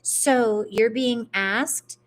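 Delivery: ordinary delivery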